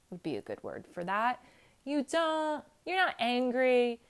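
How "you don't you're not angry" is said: usual speaking voice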